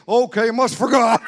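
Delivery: slow, loud, laughing give-in; laughing shout. Retold or imitated. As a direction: shout it out while cracking up.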